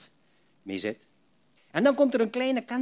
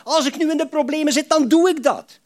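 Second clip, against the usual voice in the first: impassioned plea; 8 semitones higher, 7 dB louder than usual. Acted. Voicing Asaf's plea.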